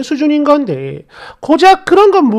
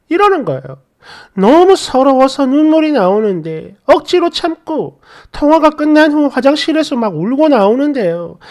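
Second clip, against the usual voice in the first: usual speaking voice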